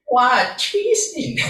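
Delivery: laughing mock disbelief at "Cheating?"; surprised question. Real, not acted.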